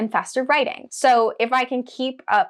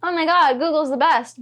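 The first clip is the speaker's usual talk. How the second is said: higher and louder, a hammed-up fangirl rave; mock gushing; 7 semitones higher, 4 dB louder than usual. Poking fun at tech fans, a mock-excited rave. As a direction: gush like a Google superfan, tongue in cheek. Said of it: "Not to, like".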